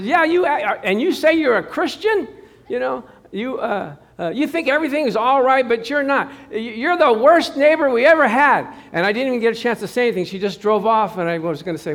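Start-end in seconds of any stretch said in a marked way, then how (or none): 0.0-8.7 higher and loud, scolding; mock angry neighbor. Neighbor in her car scolding the preacher, mock angry. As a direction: tell him off. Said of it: "She says".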